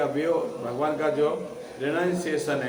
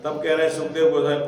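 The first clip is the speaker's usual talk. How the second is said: ordinary talk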